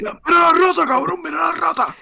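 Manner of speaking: high mock shout of his father pointing; shouting dad. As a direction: yell "look at the rat" like dad. Imita al padre burlándose de EE.UU. (padre gritando agudo, imitado)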